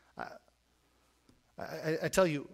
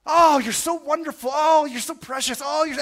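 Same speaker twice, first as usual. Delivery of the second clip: hammed-up gushing, much higher and louder; gushing flattering preacher; 10 semitones higher, 10 dB louder than usual. Mocking a popular preacher who flatters his audience, gushing loudly. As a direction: gush over the crowd like a showman preacher.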